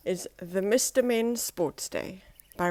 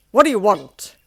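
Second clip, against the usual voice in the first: loud, curt rude snap; Mister Uppity snapping at Mister Happy, loud and rude; 11 dB louder than usual. Acted. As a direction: bark it at the unwelcome visitor. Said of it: "he snapped".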